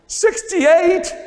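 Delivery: high, loud wail of a distraught student; teacher imitating his student's dismay at her grade; upset teenage girl. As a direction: wail the score like a crushed schoolgirl. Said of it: "She started crying".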